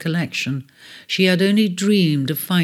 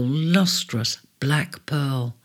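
ordinary delivery, nothing marked

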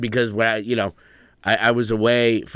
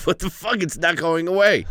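mock dismay; 8 semitones higher than usual. Frustrated while wiping on cruise.

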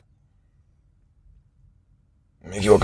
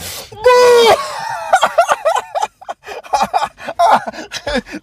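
shrieking laugh. Real, not acted. Player bitten on the ear mid-game, shrieking laugh. Friend bites his ear.

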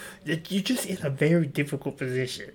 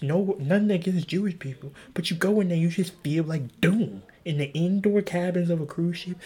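ordinary talk

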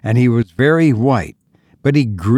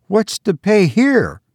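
cried out; 10 semitones higher than usual. Acted. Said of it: "he cried".

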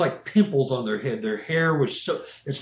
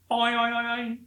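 high bouncing oing sounds; cartoon spring sounds for stiff hair; springy boing noise; 9 semitones higher than usual.

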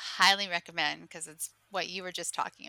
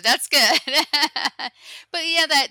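laughing; 8 semitones higher, 14 dB louder than usual. Real, not acted. Host still laughing.

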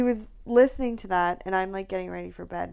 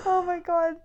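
laughing until it almost turns to crying, pitched way up; high helpless laugh; 11 semitones higher, 4 dB louder than usual. Real, not acted. Wife laughing at her own pettiness, high teary giggles. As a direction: crack up, then a teary oh my God.

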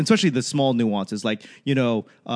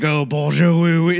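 mock French tourist; 4 semitones higher, 7 dB louder than usual. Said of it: "They're like".

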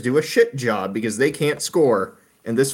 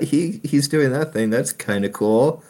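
normal talking voice

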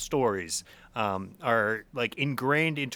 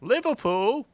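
put-on Liverpool accent, higher and louder; hammed-up Scouse accent; bad British accent; mock British accent; 10 semitones higher, 5 dB louder than usual. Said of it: "My accent sucks".

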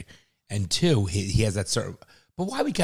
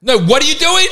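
loud, high exasperated yell; shouting at mom; 13 dB louder than usual. Real, not acted. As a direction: yell across the house in disbelief. Yelling at mom in kitchen.

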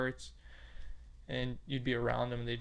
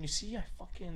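excited whisper; 5 semitones higher, 7 dB quieter than usual. Retold or imitated. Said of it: "And they're like".

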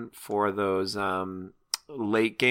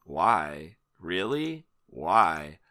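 retold sceptical thoughts; 4 semitones lower, much slower than usual. Player voicing thoughts while looking through new cards, sceptical. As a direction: question each card under your breath, unimpressed. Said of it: "those were my thoughts".

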